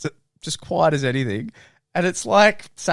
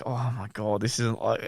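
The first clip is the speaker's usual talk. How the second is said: soft, low cringing aside; embarrassed under the breath; mortified mutter; 7 semitones lower, 9 dB quieter than usual. Retold or imitated. Mortified at the red light, muttered low and soft.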